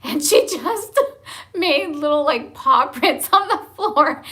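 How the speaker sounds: high, giggly telling, words pushed through laughter; laughing while talking. Real, not acted. Mom describing youngest daughter's paw prints, laughing as she talks. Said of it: "I'm laughing now 'cause it really was hilarious".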